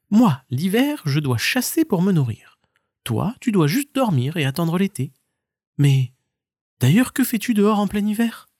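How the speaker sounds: high stoat; light high answer. Acted. Stoat answers the marmot, high and light.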